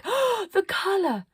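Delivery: very high, slow rapturous cry. Acted. Arcadia admires the children, very high slow cry. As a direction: swoon over it. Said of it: "saying things like".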